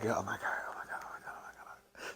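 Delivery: whispered oh my God, fading away; panicked whisper. Retold or imitated. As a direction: breathe it out in panic.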